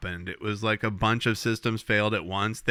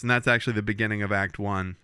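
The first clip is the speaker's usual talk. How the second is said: usual speaking voice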